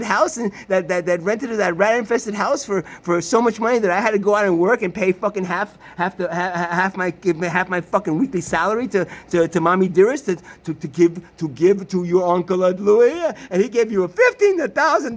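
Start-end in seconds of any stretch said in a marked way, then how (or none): none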